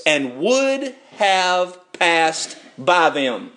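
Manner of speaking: drawn out, word by word; slow emphasis. Shown as a demonstration. Preacher pointing congregation to the last phrase, slow and deliberate. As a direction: spell it out slowly for them to catch. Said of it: "now watch this last phrase".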